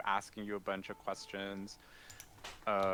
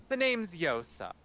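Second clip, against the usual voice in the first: archmage introduction; 9 semitones higher, 5 dB louder than usual. Acted. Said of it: "goes like".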